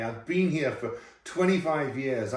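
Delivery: ordinary delivery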